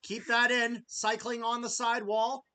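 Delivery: loud excited hockey call; play-by-play commentary. Acted. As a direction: call the save like a broadcaster.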